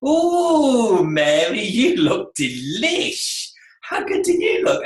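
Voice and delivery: high, drawn-out "ooh" of admiration; gushing compliment. Acted. First year to freshly styled client Mary, gushing. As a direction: gush over a client, stretching the words.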